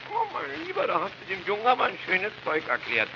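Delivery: high, sing-song mockery; mock pity. Relative mocking the father's explanation, high teasing. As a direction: tease him for his silly answer.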